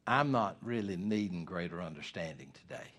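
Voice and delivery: quiet, humble confession to God; humble prayer. Pretend. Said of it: "say to the Lord".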